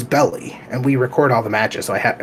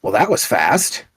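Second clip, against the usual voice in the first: dry remark; 3 semitones lower, slower than usual. Retold or imitated. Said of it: "I was kinda like".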